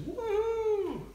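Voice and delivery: long, high "woo"; playful whoop. Pretend. Joking about lifting a kilt, playful whoop. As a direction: let out a high-pitched whoop.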